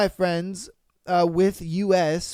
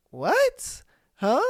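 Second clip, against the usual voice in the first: confused family; 7 semitones higher than usual. Retold or imitated. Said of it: "they're like".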